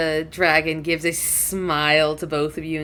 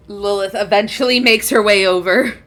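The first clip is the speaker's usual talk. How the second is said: ordinary delivery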